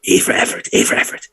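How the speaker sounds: whispered goblin verdict. Acted. Goblin ticket-taker letting them through, whispered. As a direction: breathe it out grudgingly. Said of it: "in the front says".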